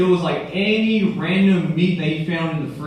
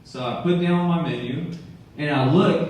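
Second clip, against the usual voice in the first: ordinary delivery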